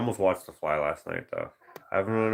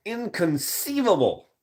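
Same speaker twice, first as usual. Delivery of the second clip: movie quote; 12 semitones higher, 4 dB louder, slower than usual. Retold or imitated. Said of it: "But the Inconceivable".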